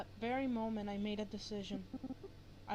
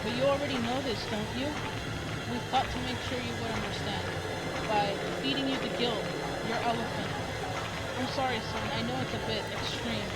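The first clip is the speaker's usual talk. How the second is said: dream parents voice; 2 semitones higher, 7 dB louder than usual. Acted. Dead parents speaking to their son in his dream, loud and eerie. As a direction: play the ghostly parents booming over their son.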